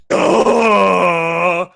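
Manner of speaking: loud mocking duh, drawn out. Parents mocking their son's request with a loud duh. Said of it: "they make fun of him going".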